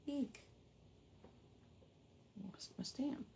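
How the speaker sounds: whispered aside to yourself. Real, not acted. Crafter whispering about losing her stamp. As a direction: breathe it out under your breath. Mutters after dropping stamp.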